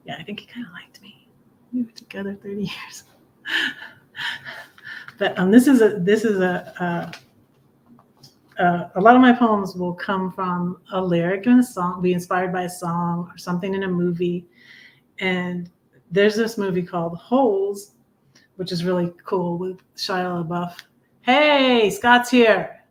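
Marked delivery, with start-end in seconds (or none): 0.1-3.0 soft unvoiced whisper, half to herself; whispered aside. Real, not acted. A shy whispered aside to the audience about her husband. 21.2-22.8 higher, louder hey as a friend walks in; excited greeting. Real, not acted. Spotting Scott arriving late, excited welcome.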